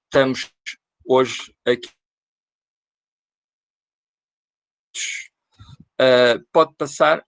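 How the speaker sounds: choppy, breaking-up connection; corrupted glitchy audio. Real, not acted. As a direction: audio cutting in and out. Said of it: "o áudio tá falhando".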